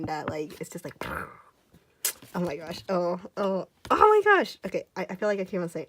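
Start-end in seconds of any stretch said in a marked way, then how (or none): 3.9-4.6 high, loud burst of amazement; excited squeal. Real, not acted. Fan dazzled by shiny gold, excited squeal.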